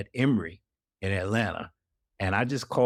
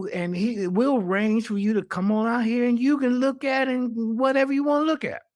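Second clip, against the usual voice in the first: eager invitation; 9 semitones higher than usual. Retold or imitated. Widow inviting him to see files.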